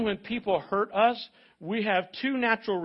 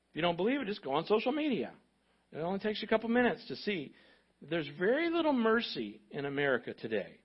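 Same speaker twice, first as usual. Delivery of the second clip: usual speaking voice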